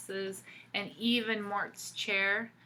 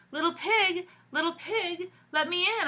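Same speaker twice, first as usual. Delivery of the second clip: calling the pig; 10 semitones higher, 7 dB louder than usual. Acted. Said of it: "I called".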